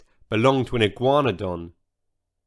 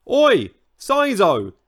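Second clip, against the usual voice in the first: louder, higher, slow angry roar; 7 semitones higher, 5 dB louder, slower than usual. Acted. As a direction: roar his name across the forest. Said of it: "he roared".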